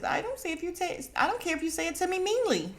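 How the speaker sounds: high, quick put-on snooty voice; high, fast snooty imitation of a cast member; mocking retort. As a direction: toss it off like you don't care. Gizelle mocked, retorting.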